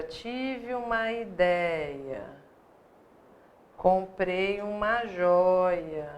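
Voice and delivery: very slow, stretched "ideia" and "joia"; drawn-out example. Shown as a demonstration. Teacher reminding students of the example words, slowly drawn out. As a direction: draw out the open vowels in "ideia" and "joia".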